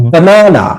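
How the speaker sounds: Banana with a high, long middle "na"; syllable stress. Shown as a demonstration. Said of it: "একটা করে হাততালি দিবে".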